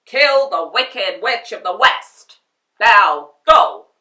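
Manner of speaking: booming harsh order; loud command. Acted. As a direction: order it sharply. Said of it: "it snapped".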